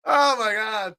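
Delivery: sudden high, loud cry of disbelief; exasperated outburst. Real, not acted. Sports host hearing unwelcome Chip Kelly rumors, exasperated outburst. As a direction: blurt it out like the news is too much.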